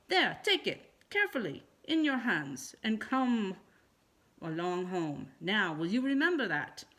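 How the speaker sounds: a mother lecturing her silly son; scolding mother. A narrator's reading.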